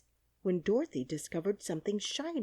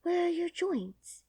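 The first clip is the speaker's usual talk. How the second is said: anxious; 13 semitones higher than usual. Acted. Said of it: "asked anxiously".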